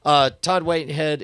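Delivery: usual speaking voice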